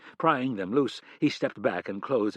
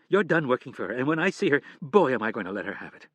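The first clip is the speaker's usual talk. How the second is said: angry; 3 semitones higher, 6 dB louder, faster than usual. Acted. Wife vows revenge on boss.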